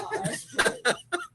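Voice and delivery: very high squealing laugh. Real, not acted. Laughing at herself.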